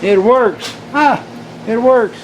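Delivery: delighted outburst, high and loud; high, loud cry of success; excited relief. Real, not acted. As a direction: exclaim it in triumph as it finally works. Gadget works after long wait.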